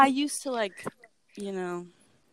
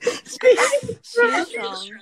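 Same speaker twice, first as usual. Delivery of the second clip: dramatic declaration; 12 semitones higher, much slower than usual. Acted. Reenacting her famous tweet line.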